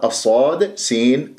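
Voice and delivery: ordinary delivery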